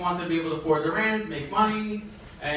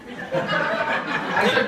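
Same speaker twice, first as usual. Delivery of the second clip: ordinary delivery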